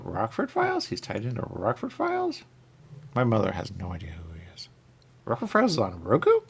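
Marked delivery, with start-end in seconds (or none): 3.5-4.7 quick whispered aside. Real, not acted. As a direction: whisper it fast, as a secret.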